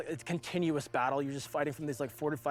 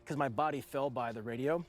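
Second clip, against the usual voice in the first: normal talking voice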